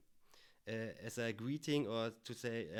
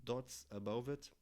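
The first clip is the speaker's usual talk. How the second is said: normal talking voice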